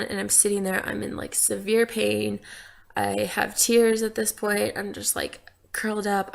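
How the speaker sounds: usual speaking voice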